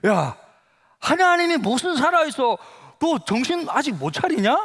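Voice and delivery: acted angry outburst, pitched high; angry retort. Classmate fed up with the church invitation, imitated anger. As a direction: snap back like an annoyed schoolmate. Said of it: "막 화를 내는 거야".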